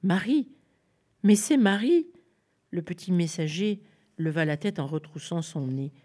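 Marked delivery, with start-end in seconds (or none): 0.0-2.1 louder, higher cry of recognition; Élisabeth recognising her cousin, surprised. Acted. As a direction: gasp out the name she recognises. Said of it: "d'une voix étonnée".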